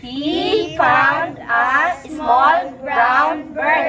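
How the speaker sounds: word by word, very slow; slow model reading. Shown as a demonstration. Teacher reading a story to class, slowly word by word. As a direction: stretch each word for learners to follow.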